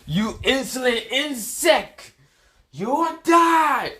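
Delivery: high, drawn-out villain screech; Frio's villain rant. Acted. Frio threatening Boruto, high furious villain voice. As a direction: stretch each threat, building to a loud high shout. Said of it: "Frio was like".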